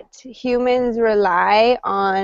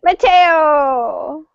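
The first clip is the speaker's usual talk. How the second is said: higher, drawn-out name call; excited calling out; 5 semitones higher, 3 dB louder than usual. Real, not acted. Teacher hailing a student who reappears, excited call. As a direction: call the name out long and high.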